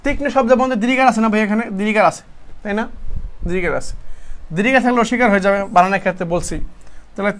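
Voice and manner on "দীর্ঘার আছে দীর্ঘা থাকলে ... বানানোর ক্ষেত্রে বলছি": usual speaking voice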